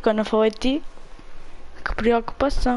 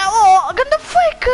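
excited yell; 9 semitones higher, 4 dB louder, much slower than usual. Real, not acted. Reacting to a fake in game.

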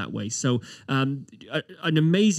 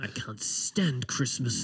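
whisper; 7 dB quieter, much slower than usual. Acted. Scrooge rant, whispered.

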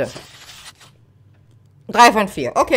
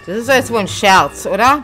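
raging gamer; 7 dB louder than usual. Real, not acted. Gamer annoyed at game trap.

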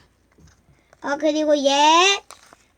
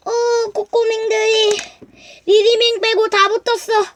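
distressed toy voice; 5 semitones higher, slower than usual. Acted.

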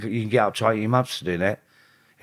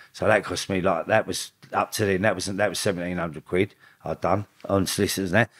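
ordinary talk, nothing marked

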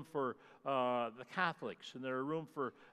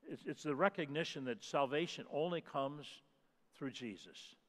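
normal talking voice

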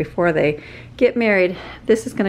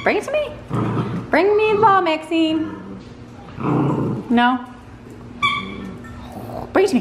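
much higher coaxing call to the dog; high pet talk; 9 semitones higher than usual. Real, not acted. Mom calling the dog to bring back the ball, high coaxing voice.

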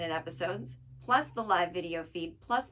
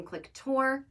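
ordinary delivery

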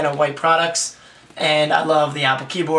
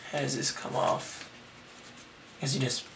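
muttering to himself; 13 dB quieter than usual. Real, not acted. Muttering while opening box.

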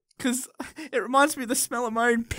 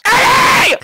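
sudden high loud yell; shouting; 12 dB louder than usual. Acted. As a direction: bark to clear the room. Shouting people out of way.

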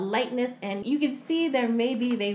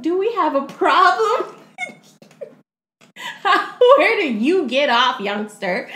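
mock confrontation; 7 semitones higher, 9 dB louder than usual. [name] confronts young guy.

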